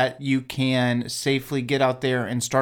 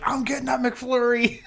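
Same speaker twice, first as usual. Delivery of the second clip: mock shout; 10 semitones higher than usual. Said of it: "the person is like".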